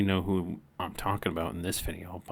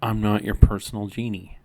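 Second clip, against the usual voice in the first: retold firm declaration; 9 dB louder than usual. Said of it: "I said".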